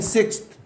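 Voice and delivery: सिक्स्थ with the final th sounded; pronunciation demo. Shown as a demonstration. Teacher shows students the class name, pronunciation demo of सिक्स्थ. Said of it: "आप क्या बोलते हैं".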